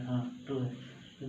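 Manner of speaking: ordinary delivery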